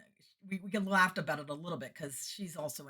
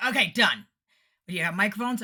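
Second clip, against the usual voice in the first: loud, raised, decisive delivery; brisk take-charge; 5 semitones higher, 11 dB louder than usual. Retold or imitated. Sound guy checking the singer's gear, loud and decisive. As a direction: rattle it off as a confident tech.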